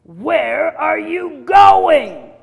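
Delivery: high, very loud, drawn-out roar; big bad wolf shouting slowly; angry wolf bellow. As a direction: stretch each word into a bellow. Wolf catches runaway girl.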